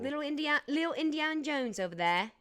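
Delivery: deliberately bad British accent; hammy fake British voice. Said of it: "I'm purposefully doing it bad".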